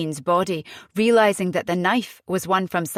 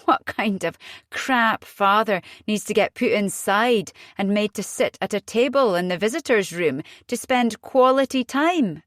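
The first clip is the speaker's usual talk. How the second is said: ordinary delivery, nothing marked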